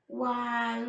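plain reading voice

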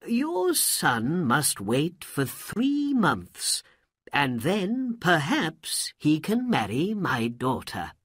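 slow, admiring royal voice; impressed Sultan. Acted. Sultan gives his ruling.

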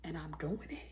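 whispered, breathy with excitement. Real, not acted. Whispering her news to viewers. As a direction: breathe it out like a secret. Excited secret about new job.

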